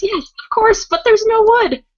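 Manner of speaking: very high mock panic; Hermione freaking out. Retelling a flustered witch's reply to her friend, mock panic. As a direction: squeal it in hammed-up distress. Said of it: "freaking out".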